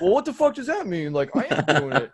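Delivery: high, loud indignant whine with a laugh; laughing mock indignation; mock outrage. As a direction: play the fed-up asker, half laughing. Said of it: "people get mad at that answer".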